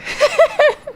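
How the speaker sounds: a quick high burst of laughter. Real, not acted. Laughing at friend's reaction.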